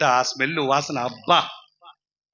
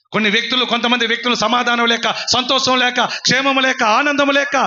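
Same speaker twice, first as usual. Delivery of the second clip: rising preaching; 7 semitones higher, 4 dB louder than usual. Acted. Preacher listing what people lack, rising preaching. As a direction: list climbing steadily in pitch.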